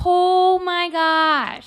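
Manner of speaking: slow, high, loud drawn-out exclamation; drawn-out oh my gosh. Real, not acted. To her friend, recalling the trip, stretched high exclamation. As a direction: stretch it out in disbelief.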